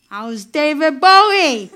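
loud, drawn-out holler over a fence; calling out. Retold or imitated. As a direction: yell it across the street, slow and loud. Construction workers calling over fence.

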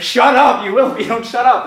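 high, quick, fed-up friend; exasperated retort. Retold or imitated. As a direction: cut off a fretting friend, exasperated. Exasperated friend snaps back, escalating.

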